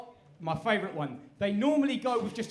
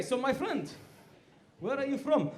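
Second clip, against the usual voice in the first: lightly voiced Turkish barber, higher pitch; 5 semitones higher than usual. Barber repeats question to customer, light Turkish accent. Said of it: "the question is coming".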